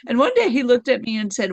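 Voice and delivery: ordinary talk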